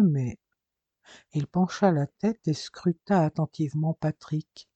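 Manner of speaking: ordinary delivery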